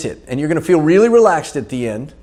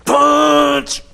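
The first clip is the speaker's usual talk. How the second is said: coaching call; 12 semitones higher than usual. Real, not acted. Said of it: "Here, inhale".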